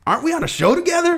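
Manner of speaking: very high, quick, loud impression; Ashton Kutcher. Co-host asking the other celebrity, high impression. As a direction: squeal it fast as a goofy actor. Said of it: "Why did I throw Ashton Kutcher?".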